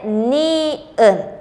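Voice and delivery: "ni-en" slowly, in two separated syllables; letter name sounded out. Shown as a demonstration. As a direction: stretch "ni-en" syllable by syllable. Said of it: "es la consonante". ("ni-en" lento, sílaba por sílaba)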